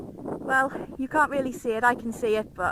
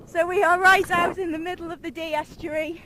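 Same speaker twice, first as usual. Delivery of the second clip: shouting over wind; 5 semitones higher, 10 dB louder than usual. Real, not acted. Outdoors on windy sand flats.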